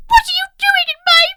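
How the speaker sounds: very high loud scolding; loud high outraged question; indignant bears. Acted. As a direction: demand it angrily. Bears confront Goldilocks.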